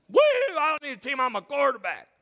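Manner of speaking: whooping, high and loud quarterback boast; hammed-up cocky whoop; mock bravado. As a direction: crow it fast like a show-off. Mocks boastful teamless quarterback.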